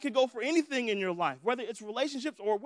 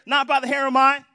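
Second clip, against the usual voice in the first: louder, higher chorus of pigs; pigs refusing together; 4 semitones higher, 14 dB louder than usual. Retold or imitated. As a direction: shout it back like the three pigs. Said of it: "all the pigs say together".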